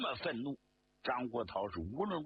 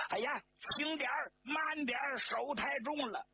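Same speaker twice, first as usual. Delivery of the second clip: 高声哭腔求饶; high, whining cry of pain; 杀猪似的叫; 12 semitones higher than usual. A narrator's reading. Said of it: "像杀猪似的就叫起来了". (杀猪般地叫唤)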